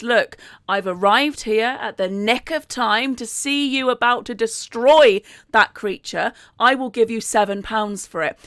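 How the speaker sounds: usual speaking voice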